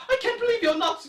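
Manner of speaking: high, loud mock British accent. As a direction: imitate her in an exaggerated British accent. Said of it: "why I give her a British accent".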